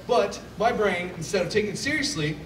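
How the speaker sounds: ordinary talk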